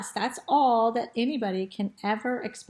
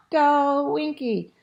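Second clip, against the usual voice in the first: higher, louder cheering shout; crowd shout; 6 semitones higher, 6 dB louder, slower than usual. A narrator's reading. Crowd urging Winky to the finish, shouting. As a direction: shout the racer on. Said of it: "crowd shout".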